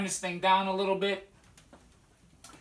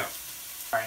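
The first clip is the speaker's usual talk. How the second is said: whispered aside; 8 semitones lower, 8 dB quieter than usual. Real, not acted.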